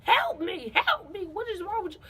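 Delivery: woozy mock plea for help; dazed pleading. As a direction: play it dizzy and begging. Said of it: "but I'm just like".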